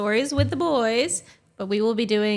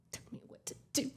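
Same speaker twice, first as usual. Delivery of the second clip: whispered mock retort; hushed defiance; 16 dB quieter, faster than usual. Fiancee defying the warning, whispered mock defiance. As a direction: mouth off under the breath, whispering.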